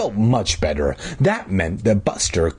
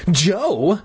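raised-pitch mock shriek; 9 semitones higher than usual. A narrator's reading. Sister seeing the melons, shrieking at Joe. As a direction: call out the name, appalled. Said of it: "she shrieked".

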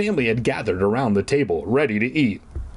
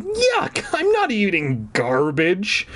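high stuck-up yuck, then slow whine; stuck-up wail; 7 semitones higher, slower than usual. Acted. Friend refusing to eat garbage, a stuck-up wail. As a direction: wail like a spoiled snob. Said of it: "Fergie wailed like some sort of stuck-up".

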